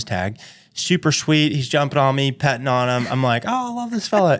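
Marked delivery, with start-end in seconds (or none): 3.5-4.4 high sing-song coo at the dog; doting on a dog. Retold or imitated. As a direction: gush over the sweet dog. Cooing at a sweet dog.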